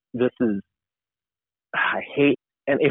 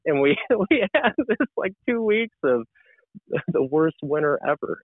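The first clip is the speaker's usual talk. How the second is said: higher, laughing while telling it; 7 semitones higher than usual. Real, not acted. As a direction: laugh at the irony. Laughs telling of the bad winter.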